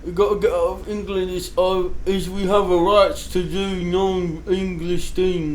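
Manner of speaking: hammed-up British settler accent, slowing down; mock British accent. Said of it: "How did we lose that accent?".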